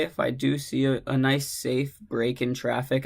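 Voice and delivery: usual speaking voice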